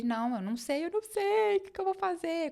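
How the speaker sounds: words cracking upward into tears; crying. Retold or imitated. Answering the other boss about leaving, crying. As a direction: voice climbing into high tearful cry. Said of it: "Comecei a chorar".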